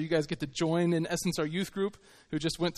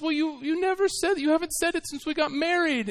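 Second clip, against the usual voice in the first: high, complaining wife's voice; the wife pleads, pitched high; hurt wife; 11 semitones higher than usual. Retold or imitated. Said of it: "And she says".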